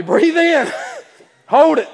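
loud, high breathing cue; big imitated preacher's command; calling out instruction. Leading crowd in breathing exercise.

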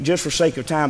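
usual speaking voice

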